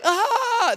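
very high, loud mock-terrified shriek; comic shriek of sheer fright; panicked scream. Shepherds still freaked out at the angel, panicked scream.

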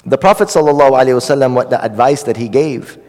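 ordinary talk, nothing marked

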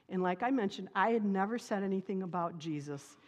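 ordinary talk, nothing marked

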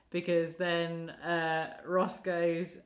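normal talking voice

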